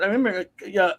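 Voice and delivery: ordinary delivery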